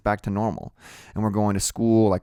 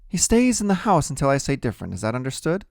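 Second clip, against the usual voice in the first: mother's rule-setting; 6 semitones higher, faster than usual. A narrator's reading.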